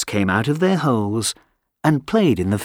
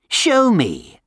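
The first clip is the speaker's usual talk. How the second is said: higher, pushy dare; challenging wind; 9 semitones higher than usual. Acted. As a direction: throw down the challenge.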